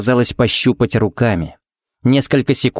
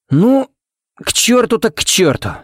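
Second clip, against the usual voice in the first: high-pitched, sand-in-the-throat retort; dry, unexpectedly loud; 11 semitones higher than usual. A narrator's reading. Stung Bullock answers Ted in the silence, dry and unexpectedly loud. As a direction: throw it back dryly, pitch jumping well up. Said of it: "Сухо, будто в горло ему натолкали песка и одновременно неожиданно громко".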